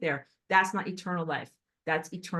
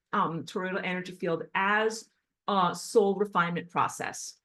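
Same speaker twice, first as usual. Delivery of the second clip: ordinary talk, nothing marked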